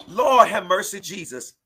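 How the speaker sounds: ordinary talk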